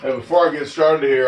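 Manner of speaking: normal talking voice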